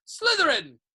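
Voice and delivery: high, loud ringing cry; hat's scream. A narrator's reading. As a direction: scream the house name at once. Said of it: "it screamed".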